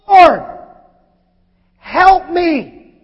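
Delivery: loud, high cry for help; desperate plea. Acted. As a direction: beg him for help. Woman kneels and pleads.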